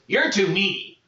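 a hungry goblin sizing him up. Acted. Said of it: "and he goes".

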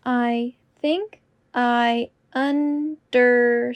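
slow and deliberate. Shown as a demonstration. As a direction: say it slowly and clearly. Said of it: "repetition drill, slowed take".

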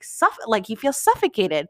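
ordinary talk, nothing marked

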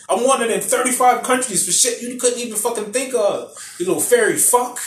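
high, mock-angry bragging rant; Kano. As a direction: go in on them with hammed-up swagger. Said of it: "He starts going in".

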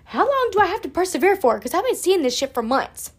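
high, loud, whiny "how long do I have to persevere"; mock-plead with the universe for a break; exasperated complaint. Woman retelling her exasperation at the angel numbers, high and whiny. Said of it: "And I was like".

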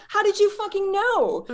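very high, drawn-out incredulous question; astonished disbelief. Retold or imitated. Client demanding how healer knew, astonished. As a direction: retell being utterly floored.

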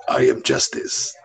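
low, slow deadpan hero imitation; Batman. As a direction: intone it as a monotone vigilante. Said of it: "Batman, if we put him as an evil person".

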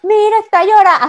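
mocking relative. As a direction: imitate a cousin jeering at a crying kid. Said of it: "así como el niñito gordo de la escuela que lo agarran y lo comienzan a bullear".

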